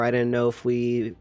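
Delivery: ordinary talk, nothing marked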